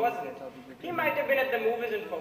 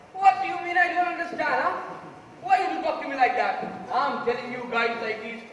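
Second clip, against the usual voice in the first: angry; 5 semitones higher, 4 dB louder than usual. Acted. Juror takes offence at remark.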